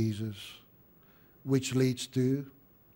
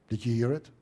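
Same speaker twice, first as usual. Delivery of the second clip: usual speaking voice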